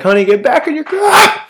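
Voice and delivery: high, loud panicked plea to her man; mock-frantic high yell at her fiancé; frantic girlfriend yelling. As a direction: shriek it like she's scared for him.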